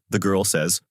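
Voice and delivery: usual speaking voice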